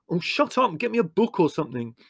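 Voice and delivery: high, irritated order; exasperated retort. Acted. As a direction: cut off the panicker, exasperated. Leslie snapping at Margot.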